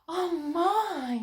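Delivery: a high, amazed little gasp of words; surprised exclaim. A narrator's reading. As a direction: catch your breath in wonder. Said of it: "Molly exclaimed".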